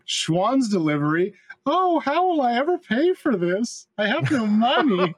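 high, hammed-up damsel voice; mock porn scene voice. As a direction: play the helpless housewife, hammy.

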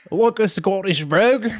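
unsure stab at a Scottish accent; attempted Scottish brogue. Shown as a demonstration. Player tests the suggested accent, tentative Scottish brogue. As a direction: try a Scottish brogue, questioningly. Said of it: "I have no idea how to do that".

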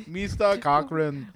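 slow, lightly faked African accent; half-hearted African accent; mock African accent. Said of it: "I'm trying to do an African accent".

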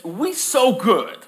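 high, slow, grand boast; God admiring his own goodness; a lightly hammed-up proud creator. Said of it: "So God says".

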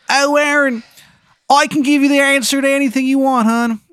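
high, loud, bad Maryland accent; Shithead Steve's terrible Maryland accent. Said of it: "he's got a terrible Maryland accent".

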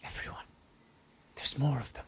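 hushed in-character warning; whispering. Acted. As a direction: keep it hushed, a secret warning. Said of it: "whisper a little louder for the mic".

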